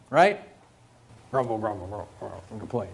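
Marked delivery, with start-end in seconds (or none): 1.3-2.9 low, soft, muttered mock grumble; mock grumbling. Shown as a demonstration. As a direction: grumble under your breath, playfully mocking. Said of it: "Our first thought is to grumble and complain".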